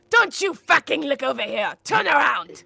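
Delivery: loud, high-pitched yelling at gawkers; angry protective shout. Acted. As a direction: yell at everyone standing there to back off. Orders bystanders not to watch.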